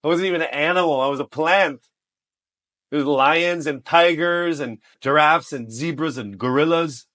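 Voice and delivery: loud, high protest; comic indignation. Real, not acted. Model cast as a plant among animals, loud mock indignation. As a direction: exclaim it in comic outrage.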